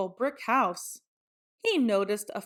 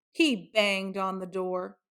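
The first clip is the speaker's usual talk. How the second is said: ordinary delivery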